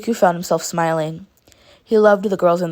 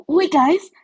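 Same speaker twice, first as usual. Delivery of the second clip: high and loud sudden call; surprised; 10 semitones higher, 5 dB louder than usual. A narrator's reading. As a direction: stop them in alarm. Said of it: "Mina said in surprise".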